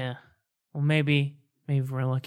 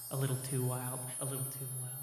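each repeat softer and softer; fading echo; 9 dB quieter than usual. Acted. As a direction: let it fade away like an echo.

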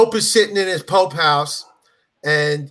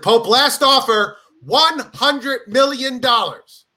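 slow, raised-pitch big announcement; mock grand final offer; 5 semitones higher, slower than usual. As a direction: stretch out the huge sum for drama. KFC makes final big offer.

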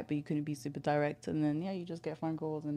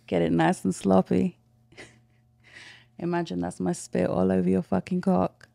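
teasing, commanding, a bit louder; sultry phone sex voice; 2 semitones higher, 8 dB louder than usual. Shown as a demonstration. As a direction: play the dominant caller. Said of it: "get in full character".

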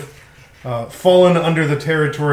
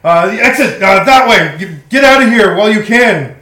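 loud, higher warning shout; urgent fireman; 5 semitones higher, 9 dB louder than usual. Acted. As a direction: shout them toward the exit, stumbling over words. Game master voices fireman warning players away.

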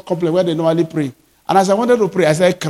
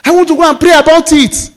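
excited retold pastor; 6 semitones higher, faster than usual. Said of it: "He say".

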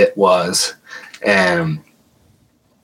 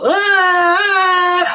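very high shrieking device tone; imitated high squeal. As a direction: shriek like the meter jumping up. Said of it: "it drops really fast".

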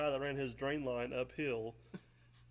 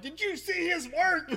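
loud, high, drawn-out indignant protest; fired up; 12 semitones higher, 6 dB louder, slower than usual. Retold or imitated. Student to his teacher on the ride home, indignant and fired up. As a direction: protest in hammed-up outrage. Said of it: "I'm fired up".

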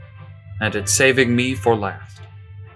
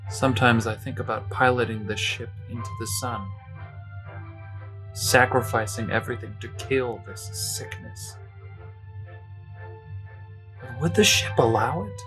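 quiet despair; 8 dB quieter than usual. Acted.

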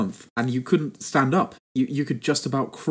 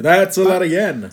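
mock echo; 6 semitones higher, 8 dB louder than usual. Echoing a friend, jokey voice.

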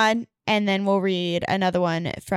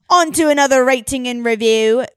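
louder, mock-British announcement; British accent; 3 semitones higher, 8 dB louder than usual. Podcast host moving to the next listener review, British accent. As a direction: slip into a loud British accent. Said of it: "I don't know why I just became British".

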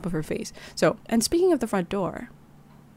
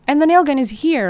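louder, higher urgent question; 6 semitones higher, 6 dB louder than usual. Acted. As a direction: press for the answer fast.

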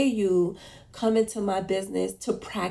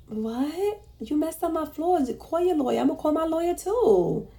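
high-pitched, indignant retort; indignant comeback; 8 semitones higher than usual. Retold or imitated. Retelling her reply to the floor contractor, indignant and high. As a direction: fire back at him in disbelief, pitched up. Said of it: "And I was just like".

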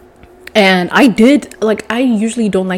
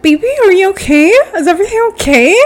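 high, fast, sugary mock concern; higher, quicker, over-sweet "are you okay"; 10 semitones higher, 4 dB louder, faster than usual. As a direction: pile on fake sweetness. Comforting crying boyfriend in car.

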